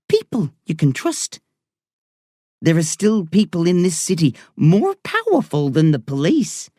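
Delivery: put-on Irish woman doctor talking to a boy patient. A narrator's reading. Doctor voiced in story.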